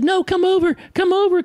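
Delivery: put-on high child's insistent call; excited child. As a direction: beg the character over, bouncing. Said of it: "And she said".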